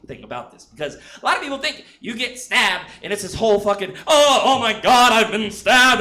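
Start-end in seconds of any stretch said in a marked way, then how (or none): 4.1-6.0 hammed-up dramatic gasp, louder and slow at the start; movie-style stab victim. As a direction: play it like a cheesy film death scene. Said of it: "like the fucking movies".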